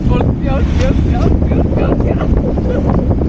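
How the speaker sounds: loud, high panicked shouting. Real, not acted. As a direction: yell as the water spills. Water spilling over him.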